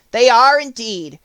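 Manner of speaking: lively Scarecrow voice; Scarecrow's voice. A narrator's reading. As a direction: play the Scarecrow, eager and light.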